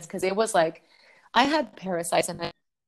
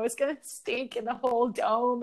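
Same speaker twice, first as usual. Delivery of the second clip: usual speaking voice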